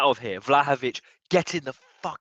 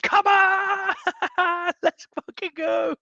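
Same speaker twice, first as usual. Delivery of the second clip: much higher shout breaking into laughter, then slow; hyped yell; 3 dB louder, slower than usual. Real, not acted. Hyped fan celebrating with chat, laughing yell. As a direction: let the joy spill out.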